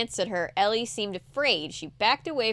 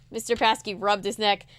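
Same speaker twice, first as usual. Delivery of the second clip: usual speaking voice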